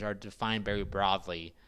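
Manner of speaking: normal talking voice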